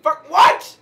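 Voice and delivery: very high, loud outcry; real shocked yell; disbelieving shout. Real, not acted. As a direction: shriek it at the screen. Disbelief at game.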